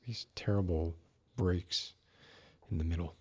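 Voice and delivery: usual speaking voice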